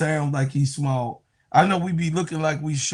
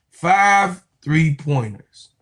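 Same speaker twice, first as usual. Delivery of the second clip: ordinary delivery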